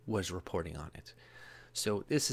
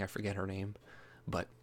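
ordinary talk

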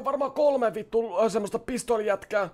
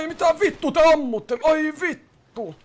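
loud, higher-pitched cursing protest; startled swearing, last words drawn out; alarmed outcry; 5 semitones higher, 5 dB louder, slower than usual. Pretend. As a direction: cry out as shots hit you. Shot at during the game.

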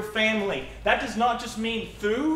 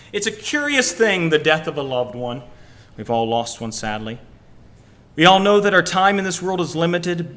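loud, dramatic book reading; reading aloud; 2 semitones lower, 6 dB louder than usual. Shown as a demonstration. Pastor reading Lemony Snicket to the church, loud and dramatic. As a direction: read the passage out with weight. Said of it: "I like this verse".

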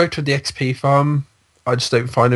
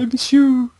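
much higher, playful put-on voice; mock pleading; 12 semitones higher, slower than usual. Friend calls lost teammate back, mock pleading. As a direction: plead in a silly high voice.

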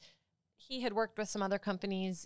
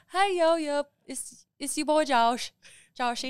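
put-on higher, louder Southern little-kid voice; Josh; 6 semitones higher, 6 dB louder than usual. Friend impersonating a favorite kid, high Southern accent. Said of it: "Do your impersonation of that one little kid".

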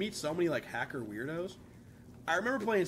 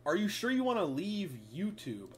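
ordinary talk

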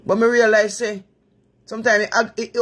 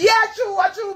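high mock agreement; 11 semitones higher than usual.

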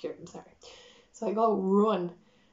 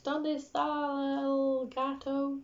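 high, drawn-out learner's Spanish; struggling beginner Spanish; 6 semitones higher, much slower than usual. Retold or imitated. Lost traveller trying Spanish on airport security, mock bad Spanish. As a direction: slowly sound out clumsy Spanish on a high monotone. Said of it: "my Spanish was not great".

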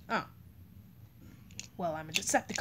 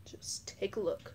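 breathy secret whisper; 8 dB quieter than usual. Pretend. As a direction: hush it like a secret. Toy play, hushed line.

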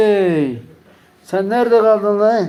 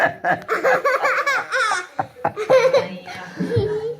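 high laugh; 4 dB quieter than usual. Real, not acted. Laughing at child's antics.

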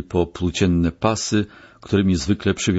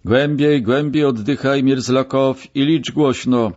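raised, higher-pitched command; urging instruction; 7 semitones higher, 3 dB louder than usual. A narrator's reading. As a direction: urge a patient going under to follow orders. Doctor instructing patient under ether. (ponaglające polecenie podniesionym głosem)

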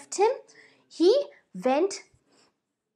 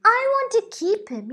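high, louder insistence; possessive boy; 7 semitones higher, 4 dB louder than usual. Acted. Boy insists to the girl he will keep the bird. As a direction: insist stubbornly. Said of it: "So the boy says".

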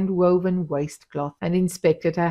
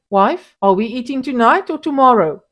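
a louder, higher pointed demand; 6 semitones higher, 8 dB louder than usual. A narrator's reading. Husband demanding his supper from his wife, loud and pointed. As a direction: press her about supper.

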